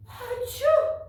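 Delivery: big high hammed-up achoo; loud fake sneeze. A narrator's reading. Mama sneezes in story.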